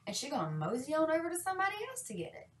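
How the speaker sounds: ordinary talk, nothing marked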